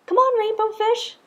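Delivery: high, loud playful call. A narrator's reading. Other fish calling Rainbow Fish to play, high and loud. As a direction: call him over to play.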